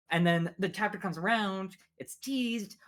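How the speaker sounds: ordinary talk, nothing marked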